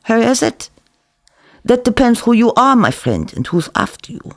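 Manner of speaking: lightly voiced café owner, sly; Zeev teasing. Cook teasing the narrator about the caller, sly.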